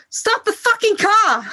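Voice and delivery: high, loud mock yell through a laugh; laughing as she yells out the stranger's warning to stop; retold yell. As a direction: belt it out like a stranger's sudden shout, half laughing. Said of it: "this mad woman yelled out".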